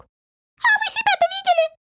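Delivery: very high, piercing little voice; squeaky retort from a tiny creature; Масик. A narrator's reading. Little man retorts to his guests, thin squeak. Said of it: "голосок тоненький и пронзительный".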